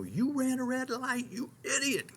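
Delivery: high, slow mock-angry comeback; mock retort. Preacher imagines snapping back at the driver, mock angry. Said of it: "shoot back at him".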